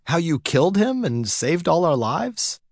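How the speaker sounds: lightly voiced astonished girl; amazed. A narrator's reading. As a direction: read as Wendy marvelling at his forgetting.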